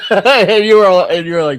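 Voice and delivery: loud, breaking into laughter; laughing through words. Real, not acted. Telling co-host his reaction, loud laughing. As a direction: push the words out while still laughing.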